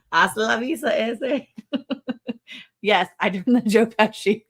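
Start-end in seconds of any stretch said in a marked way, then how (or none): 0.1-1.4 mock Californian accent with a laugh; lightly put-on, amused Californian slang accent. Repeating the Californian line for laughs, mock Californian accent. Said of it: "if a Californian says it would come out".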